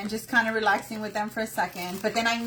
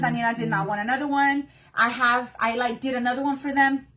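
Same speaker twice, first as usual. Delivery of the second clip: usual speaking voice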